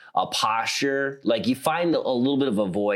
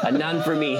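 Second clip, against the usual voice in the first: Midwest mom voice; 6 semitones higher than usual. Retold or imitated. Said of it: "her mom has this little laugh".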